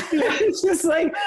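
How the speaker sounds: laughing through the words. Real, not acted. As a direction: giggle it out, overwhelmed. Retelling nervous launch-day tears.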